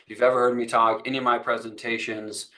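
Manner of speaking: ordinary talk, nothing marked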